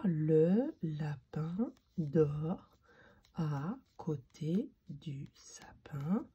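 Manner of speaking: very slow word-by-word rereading; soft, spaced-out reading with long pauses; careful proofreading. Shown as a demonstration. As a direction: read it back slowly, checking each word.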